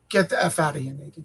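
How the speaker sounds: low, soft brush-off; mock teacher dismissal. Teacher sending a student out of class, a quiet brush-off. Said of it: "he literally was like".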